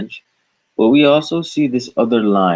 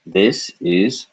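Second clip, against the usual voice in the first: ordinary talk, nothing marked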